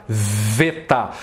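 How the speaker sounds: "veta" drawn out from an initial V, low; Greek veta. Shown as a demonstration. Teacher demonstrating the Greek letter, "veta" with clear V. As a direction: hold the V of "veta", then fall. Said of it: "Isso aqui tem som de V".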